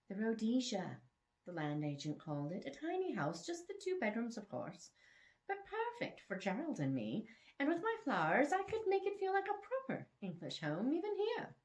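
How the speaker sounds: lightly voiced elderly English lady, rising high; prim English charm, going up at the end; English lady praising her little house, rising high. Narrator voices Mary Margaret's remembered words.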